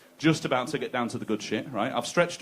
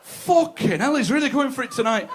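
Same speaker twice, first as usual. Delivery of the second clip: mock impressed woman; 10 semitones higher, 4 dB louder than usual.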